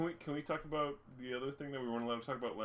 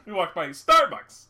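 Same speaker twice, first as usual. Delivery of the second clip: slow, loud, high callback; emphatic loud; 7 semitones higher, 15 dB louder, slower than usual. Pretend. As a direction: shout the punchline.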